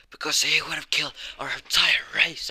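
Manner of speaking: loud, high, raging retort; furious shout. Acted. Demon defends killing the king, furious shout. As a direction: fling it back in fury.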